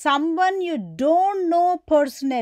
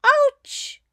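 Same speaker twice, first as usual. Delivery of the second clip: sharp cry of pain, much higher and louder; pained ouch; 13 semitones higher, 5 dB louder than usual. Shown as a demonstration. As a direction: yelp as if just bitten. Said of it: "mosquito biting a boy".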